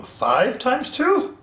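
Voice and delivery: high, slow rising question; accidental Yoda lilt, drawn out. Pretend. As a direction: let a hint of Yoda creep in. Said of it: "Did you hear that Yoda voice".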